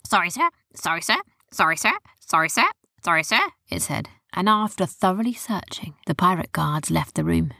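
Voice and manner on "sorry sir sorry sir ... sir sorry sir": repeated parrot apology, rising on each "sir"; confused parrot. A narrator's reading. Confused parrot apologizes to the guards, rising repeated phrase. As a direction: lilt up at each end like a puzzled parrot. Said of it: "looked all a bit confused".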